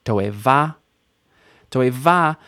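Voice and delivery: Toeva rising onto a stressed last syllable; final stress. Shown as a demonstration. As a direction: hit the closing va in Toeva. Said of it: "Final stress".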